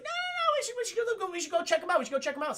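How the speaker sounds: very high, excited squeal settling down; eager kid voice. Retold or imitated. Young Luke begging to go after the raiders, very high.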